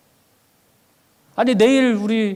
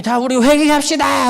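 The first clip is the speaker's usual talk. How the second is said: high, loud, drawn-out pulpit cry; pastor calling for repentance; 7 semitones higher, 5 dB louder, slower than usual. Retold or imitated. Pastor at the front calling the congregation to repent, pulpit cry. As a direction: cry out slowly to the whole congregation. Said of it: "앞에서 목사님이".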